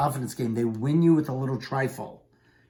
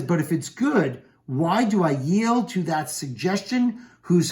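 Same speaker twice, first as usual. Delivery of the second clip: Shakespeare soliloquy reading; 4 semitones higher than usual. Acted. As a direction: weigh each question as you read it. Reads Macbeth's soliloquy aloud.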